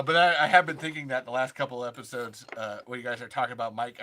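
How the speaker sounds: usual speaking voice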